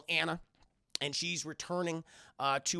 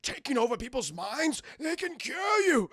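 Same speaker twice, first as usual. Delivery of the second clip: villain boast climbing high; the Doctor; 5 semitones higher than usual. Retold or imitated. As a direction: swell to a hammy, high finish. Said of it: "he talks like this throughout the entire movie".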